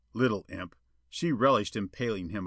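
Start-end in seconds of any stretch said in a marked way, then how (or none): none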